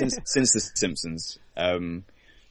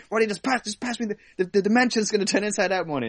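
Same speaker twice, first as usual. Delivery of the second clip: much higher, fast urgent mock Rick; frantic Rick impression; 13 semitones higher, faster than usual. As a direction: press him to hand it over. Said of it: "It's like".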